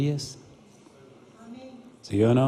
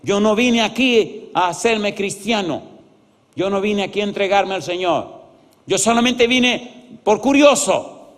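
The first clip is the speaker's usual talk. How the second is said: raised, emphatic imitation of an angry man; slow, high, indignant mock shout; furious yelling, retold; 6 semitones higher, 3 dB louder, slower than usual. Furious visitor insists to the pastor he came only out of curiosity, mock yelling. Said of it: "me gritaba y me decía".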